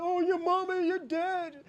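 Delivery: high, whiny mock complaint; hammed-up whining impression; mocking crybaby. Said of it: "You complain".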